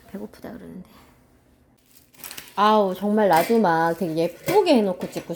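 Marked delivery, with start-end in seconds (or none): 0.1-0.9 under breath. Real, not acted. Aside while making yubu chobap.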